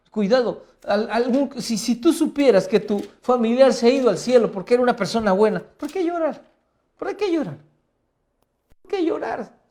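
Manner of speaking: preacher challenging the congregation's grief, booming; fiery preaching. Acted. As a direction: hammer the question home to the congregation. Preaching at full force.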